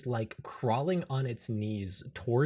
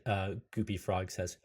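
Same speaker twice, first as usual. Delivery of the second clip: ordinary delivery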